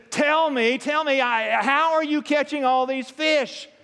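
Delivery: high, loud exasperated demand; really exasperated. Retold or imitated. Ice fisherman begging the kid a final time, exasperated. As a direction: act out someone at the end of their patience. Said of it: "now he's really exasperated".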